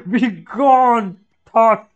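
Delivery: loud, high, hammed-up command; over-the-top exclamation; dramatic shout. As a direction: banish the thought with a big theatrical cry. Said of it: "he said, "Be gone, thought," to me".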